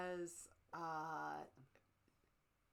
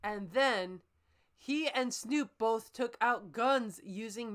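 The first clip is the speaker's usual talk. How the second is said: hammed-up story reading, building louder; dramatic fanfic reading; 7 dB louder, slower than usual. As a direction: read the bad fic like an epic.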